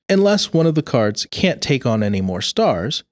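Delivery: ordinary delivery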